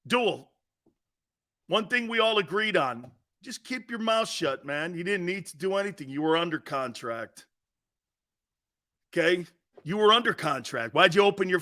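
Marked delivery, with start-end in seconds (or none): none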